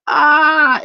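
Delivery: second high, loud falling scream; reenacted scream. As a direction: scream it out high, as if still dropping. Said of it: "still had to take another breath".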